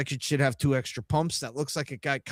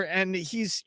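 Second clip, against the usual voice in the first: ordinary talk, nothing marked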